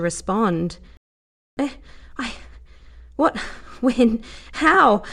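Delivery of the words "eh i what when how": halting, broken shocked stammer. A narrator's reading. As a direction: stumble over each word in shock. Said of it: "Lara stammered".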